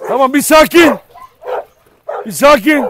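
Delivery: high, loud calm-down call. Real, not acted. Owner calming dogs after the fight, loud. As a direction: settle them with a loud firm voice.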